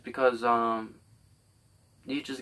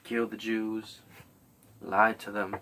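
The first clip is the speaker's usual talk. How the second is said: usual speaking voice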